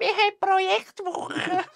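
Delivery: high giggly mock announcement; laughing mock voice. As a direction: laugh through it like a kid.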